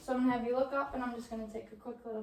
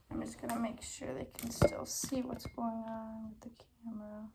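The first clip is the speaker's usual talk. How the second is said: quick whispered aside; whispering; 4 dB quieter than usual. Real, not acted. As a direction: murmur it in a whisper to yourself. Aside about the camera.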